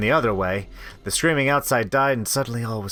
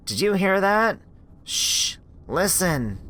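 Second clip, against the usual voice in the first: high question, then a breathy shush; hushed alarm; 8 semitones higher, slower than usual. Acted. Girl hushing her friend behind the door, alarmed.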